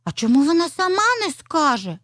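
high-pitched puzzled little fox; surprised child-character question. A narrator's reading. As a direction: ask in wonder, pitched well up. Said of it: "здивувалася Аліска".

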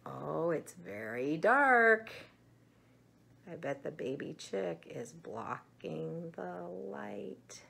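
ordinary talk, nothing marked

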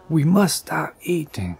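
a mild storybook cry of alarm; cried out. A narrator's reading. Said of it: "cried Toad".

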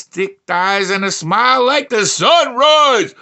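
high, loud, slowing build; building hype. Acted. Comic reads a dating profile, building loud hype.

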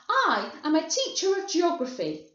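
high, loud self-important claim; emphatic retort; indignant cry. A narrator's reading. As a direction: declare it loudly, offended. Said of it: "cried Missus Salt".

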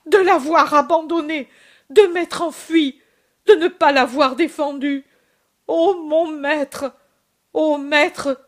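loud, high anguished cry of remorse. Acted. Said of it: "et il crie".

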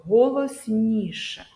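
«голосніше» drawn out slowly with extra force; slow, emphatic teacher's stress on «голосніше»; emphasised «голосніше». Shown as a demonstration. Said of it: "з більшою силою голосу".

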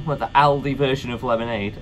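ordinary talk, nothing marked

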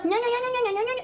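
high, soft nya-nya mimicry; mocking nagging. Maid mimicking her boss's morning nagging, mockingly. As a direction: mimic someone's nagging in high nonsense syllables. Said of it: "You get tired speaking like that".

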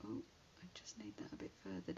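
quick hushed whisper to herself; whispered aside. Real, not acted. Adjusting the card to herself, a quick whisper. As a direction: murmur it fast while fiddling.

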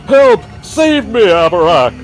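high, loud panicked screams; terrified cries for help; screaming for help. Acted. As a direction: shriek for the wizard to save you. King attacked by shapes.

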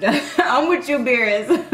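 ordinary talk, nothing marked

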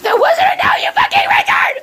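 very high shrieking outburst; furious shout. Acted. Blossom screaming at Bubbles, high furious shriek. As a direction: scream it at her in a rage. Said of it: "I'm just very emotional right now".

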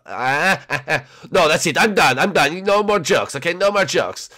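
loud, fed-up protest; mock exasperation. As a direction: put your foot down, playfully. Luigi fed up with jokes.

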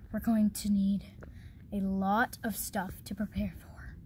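soft secretive whisper. Acted. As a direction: whisper the plan. Secret plan aside.

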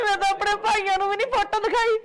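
tearful outburst. Acted. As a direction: protest through melodramatic tears. Wronged wife wailing before relatives. (ਰੋ-ਰੋ ਕੇ ਦੁਹਾਈ ਦੇਣਾ)

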